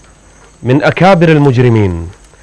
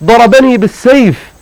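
wounded man crying out; 8 semitones higher than usual. Retold or imitated. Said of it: "فقال".

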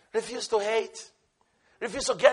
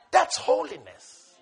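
ordinary talk, nothing marked

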